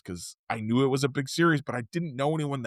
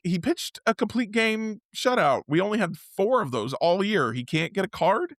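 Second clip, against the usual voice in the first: higher-pitched retold grievance; indignant protest; 7 semitones higher than usual. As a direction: protest it, rising to an incredulous question. Retold indignant complaint about a card.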